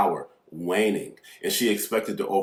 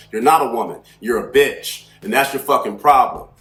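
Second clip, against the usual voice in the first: louder, driving insults, starting high; retold angry tirade; 3 semitones higher, 4 dB louder than usual. Man cussing out woman in the store, angry. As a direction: lay into them with forceful, punched insults.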